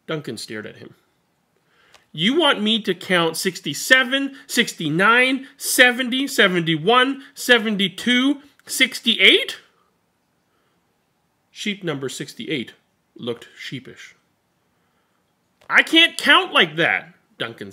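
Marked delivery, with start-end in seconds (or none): 2.1-9.6 higher and louder, disbelieving list; can't believe what he's being asked; rising, louder incredulous count. Acted. Duncan incredulous at the request. 15.7-17.1 indignant protest. Acted. Duncan protests.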